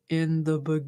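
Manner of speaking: low, deep Southern monotone; Drew's monotone Southern voice. Acted. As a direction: start a deep, ASMR-like Southern reading. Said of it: "deep Southern voice".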